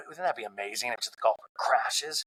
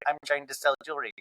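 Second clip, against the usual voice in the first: usual speaking voice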